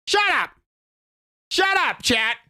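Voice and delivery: high-pitched flustered shout; shouting shut up. Real, not acted. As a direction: yell it at chat in mock outrage. Streamer yelling at chat.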